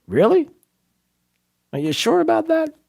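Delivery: high, incredulous challenge; mock disbelief. Real, not acted. Host mocks the culture excuse in high disbelief. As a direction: push back in high disbelief.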